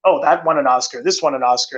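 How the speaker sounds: ordinary talk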